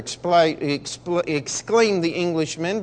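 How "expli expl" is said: usual speaking voice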